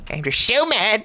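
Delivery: half-hearted mock angry character; a sloppy mock-furious voice; failed impression. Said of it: "I tried to do an impression there, but it was piss poor".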